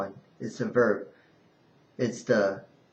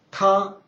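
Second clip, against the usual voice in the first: TA high and level; first tone; 7 semitones higher, 4 dB louder than usual. Shown as a demonstration. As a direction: hold TA on one high, even note. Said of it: "ta is first".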